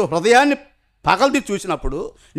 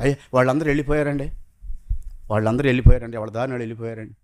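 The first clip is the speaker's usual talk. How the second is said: much lower and softer, fading humbly; humble leper; 8 semitones lower, 3 dB quieter, faster than usual. Acted. The healed leper answering the Lord, low and meek. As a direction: answer meekly, sinking to almost nothing.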